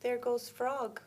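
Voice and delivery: ordinary delivery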